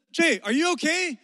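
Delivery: loud, high call to someone in the water. Retold or imitated. Friend shouting to a struggling swimmer, loud and high. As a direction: yell it to check on your friend. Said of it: "So he says".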